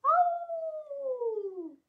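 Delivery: high, loud drawn-out howl; mock howl. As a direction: howl like a far-off dog. Distant dog howl in story.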